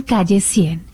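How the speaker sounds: ordinary delivery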